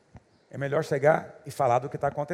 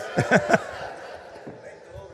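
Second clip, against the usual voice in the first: breathy laugh. Real, not acted. Laughs after the punchline.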